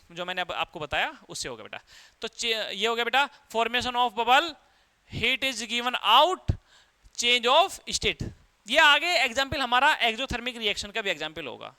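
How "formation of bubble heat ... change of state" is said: very slow, word by word so students can write; slow dictation of key points; dictating slowly. Shown as a demonstration. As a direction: spell it out for the notebook. Teacher dictating notes to copy.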